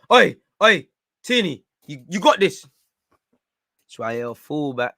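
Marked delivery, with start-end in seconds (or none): 0.1-2.7 loud, high shouts to get attention; calling out. Real, not acted. Fan yells to Tierney that he's got this, calling out. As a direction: holler urgently at a player. Calls out to player on screen.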